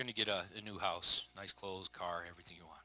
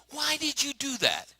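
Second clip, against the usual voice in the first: higher, slow exasperated question; exasperated parent; 9 semitones higher than usual. Retold or imitated. Parent asking a child who hurt himself, exasperated. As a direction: plead like a fed-up parent. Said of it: "you wanna say".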